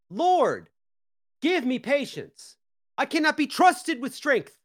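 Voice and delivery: high strained plea for patience; praying for patience through teeth. Real, not acted. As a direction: beg the Lord for patience, strained. Exasperated prayer for patience.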